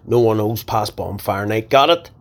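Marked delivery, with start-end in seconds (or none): 1.7-2.1 firm. Acted. Boss commanding.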